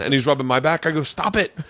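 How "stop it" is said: high, abrupt rebuff; mock exasperated protest. Dreamer to man rubbing his back, mock exasperation. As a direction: shake him off, playing annoyed.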